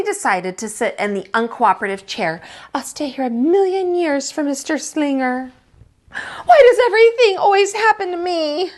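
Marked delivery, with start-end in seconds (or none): 2.7-8.7 whiny despair. Acted. Lily sulking in the chair.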